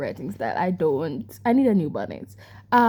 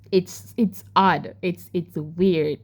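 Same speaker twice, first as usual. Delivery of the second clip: normal talking voice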